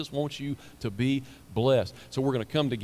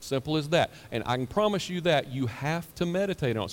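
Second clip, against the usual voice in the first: usual speaking voice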